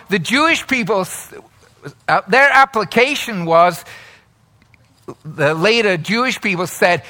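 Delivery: normal talking voice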